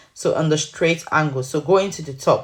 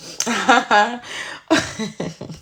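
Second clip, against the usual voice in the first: real giggling burst, higher-pitched; laughing; 5 semitones higher than usual. Real, not acted. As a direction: crack up at your own plea. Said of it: "I don't know why I'm laughing".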